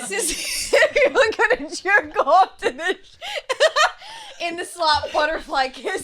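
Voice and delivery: speaking through shrieks of laughter; laughing hard. Real, not acted. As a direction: barely get the words out laughing. Talking through hysterical laughter.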